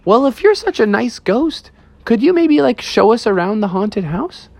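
high-pitched, tentative bear request; polite bear family. Acted. Bear family asking the ghost for a tour, high polite voice.

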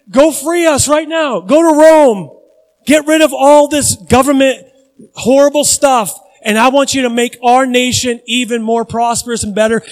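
Peter's mock demand. Peter shouting at Jesus, demanding he free them. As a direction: bang the table like a zealot demanding a king. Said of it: "He says".

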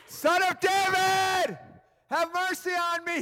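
high, long-drawn obnoxious holler. Acted. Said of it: "he only shouted louder".

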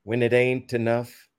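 measured spoken-word verse, long pauses between lines; impassioned poetry reading, line by line; poem recital. Acted. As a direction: deliver each rhymed line with passion, then pause.

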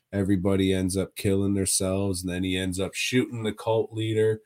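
usual speaking voice

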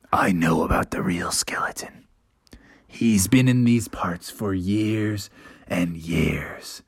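low, slow hushed storytelling; low whispered campfire tale; spooky father whisper. A narrator's reading. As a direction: whisper the legend to scare them. Father Bear tells legend.

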